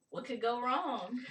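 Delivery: loud, high ironic question; exclaiming. Real, not acted. Player shrugging off the danger, loud and high. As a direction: toss it off loud with a grin.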